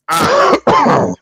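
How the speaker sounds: very high, loud yelp; loud exclamation. Real, not acted.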